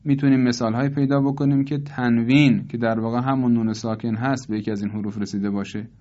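normal talking voice

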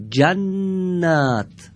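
جنت drawn out very slowly, doubled ن held; slow gemination demo. Shown as a demonstration. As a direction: stretch جنت, lean on the double n. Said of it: "از شما میپرسم".

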